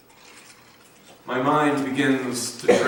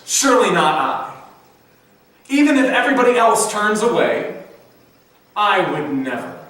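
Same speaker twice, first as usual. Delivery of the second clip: higher and louder, sure of himself; brazen Peter; 7 semitones higher, 4 dB louder than usual. Retold or imitated. Peter swearing to Jesus he won't fall away, brazen and loud. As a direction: boast that you'd never desert him. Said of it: "this brazen quality to Peter's words".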